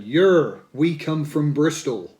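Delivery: a loud, mock Bristol accent on "Yer". A Bristol band quoted in a mock Bristol accent. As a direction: put on a broad Bristol burr. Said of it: "it would have been in that accent".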